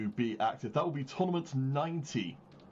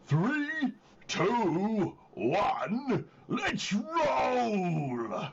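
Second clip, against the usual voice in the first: Travis ogre voice; 6 semitones higher, much slower than usual. Acted. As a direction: shout it like a video-game ogre. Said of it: "I'll do the Travis voice".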